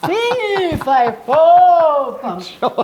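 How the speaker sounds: second, bigger take of the coach's drill. Shown as a demonstration. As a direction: push it out even bigger. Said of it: "hold on to it and go".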